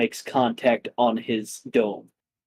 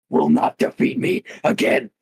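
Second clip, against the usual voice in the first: menacing; 10 semitones higher than usual. Acted. Dragon threatens Kong.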